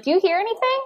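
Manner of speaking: high-pitched feigned puzzlement; mock innocent. Mother to Drew, ignoring son's fake cry, mock-innocent question.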